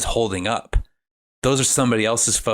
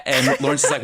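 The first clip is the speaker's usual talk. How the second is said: normal talking voice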